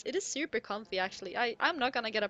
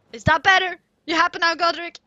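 loud, higher, playful demand; exaggerated loud retort; mock shout; 5 semitones higher, 14 dB louder than usual. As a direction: holler it at the complaining viewer in jest. Playfully answering a viewer about the accent.

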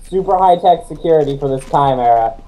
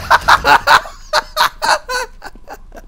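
long, high-pitched cackling; high helpless laugh. Real, not acted. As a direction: crack up helplessly. Cracking up at confusion over door.

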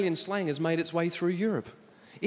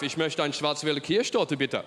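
put-on lower, stiff German accent ordering cake; mock German; 7 semitones lower than usual. Comic ordering cake in a lightly mocked German accent. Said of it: "in Germany".

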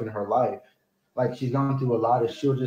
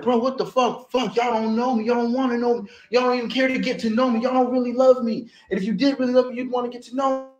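retold snapping; 10 semitones higher, faster than usual. Retelling how he snapped at his family, high mock rant. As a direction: replay the outburst, pitch way up but not shouting. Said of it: "I snapped".

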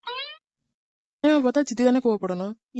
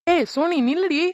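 raised, higher voice calling after her; 7 semitones higher, slower than usual. Acted. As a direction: call her back as she walks off. Calling after someone walking away.

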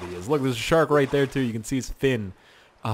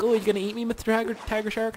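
high, timid little voice; stranded victim; 10 semitones higher than usual. Pretend. Stranded swimmer addressing a tiger shark, high pleading voice. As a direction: ask the shark in a raised mock-innocent voice. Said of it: "and then they're like".

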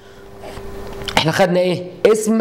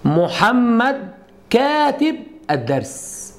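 slow, higher teacher example; slow model sentence; 4 semitones higher, much slower than usual. Shown as a demonstration. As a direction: spell out the sample sentence slowly. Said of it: "اقول لك ايه؟".

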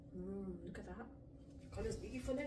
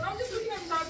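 shop woman quote; 7 semitones higher, faster than usual. Retold or imitated. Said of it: "the woman goes".

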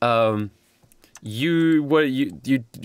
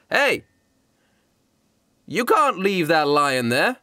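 bartender voice; 4 semitones higher, slower than usual. Pretend. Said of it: "the bartender says".

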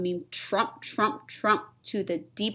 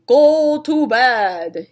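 vampire voice; 4 semitones higher, 11 dB louder, much slower than usual. Acted.

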